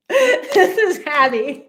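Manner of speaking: real laughter while introducing the dog, pitched high; laughing. Real, not acted. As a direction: laugh through the words as she lifts the dog up.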